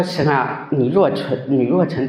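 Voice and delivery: hushed, tearful prayer; tearful plea to God. Retold or imitated. Kneeling alone, praying to God in tears. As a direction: plead softly through tears. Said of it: "我记得我当时泪流满面呐".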